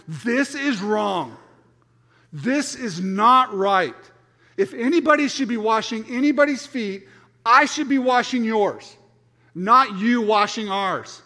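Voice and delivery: slow, loud, insistent protest; indignant Peter. Acted. Peter protests to Jesus, slow indignant protest. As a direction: Peter refusing to let Jesus wash his feet, slow and loud. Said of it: "and he's going".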